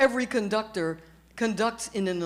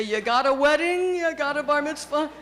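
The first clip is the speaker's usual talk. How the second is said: put-on higher-pitched Yiddish-accented fiddler hawking gigs; 7 semitones higher, 3 dB louder than usual. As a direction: tout for work with a Yiddish lilt. Wandering fiddler touting for work.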